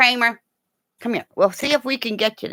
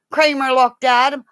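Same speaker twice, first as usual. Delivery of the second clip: ordinary delivery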